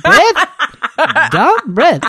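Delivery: put-on loud, high broken-English commands in a foreign accent; accented X-ray guy. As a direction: impersonate his accented breathing orders, word by word. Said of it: "exactly how he sounds".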